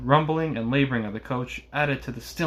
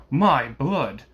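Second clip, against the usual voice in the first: louder outburst of vexation; vexed exclamation; 5 dB louder than usual. A narrator's reading. Coachman cursing the late hour, vexed exclamation. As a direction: exclaim it in sharp annoyance. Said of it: "ejaculated the vexed coachman".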